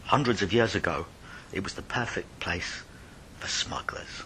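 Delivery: usual speaking voice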